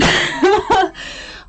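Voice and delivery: a high, loud laugh that breaks out; high burst of laughter. Real, not acted. Laughing at her own joke in chat, a high loud burst.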